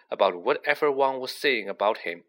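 ordinary delivery, nothing marked

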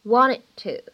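WANTED TO with the final D of "wanted" held back, unreleased; lost plosion. Shown as a demonstration. Teacher demonstrating lost plosion to learners, "wanted to" with unreleased D. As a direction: run "wanted to" together, dropping the D release. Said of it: "失去爆破的处理".

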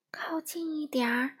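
plain reading voice

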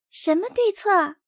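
put-on high curious child voice; high little girl cub. A narrator's reading. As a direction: ask like a small girl. Sister cub asks.

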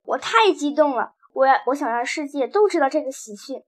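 usual speaking voice

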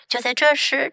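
ordinary delivery, nothing marked

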